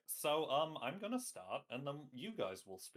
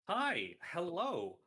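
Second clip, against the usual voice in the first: bright greeting; 7 semitones higher, 5 dB louder, much slower than usual. Real, not acted. Host greets audience.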